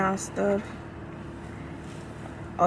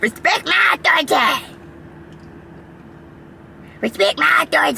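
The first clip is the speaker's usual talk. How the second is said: Cartman impression; 8 semitones higher than usual. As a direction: shout it like a bratty cartoon kid. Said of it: "talk like Carmen".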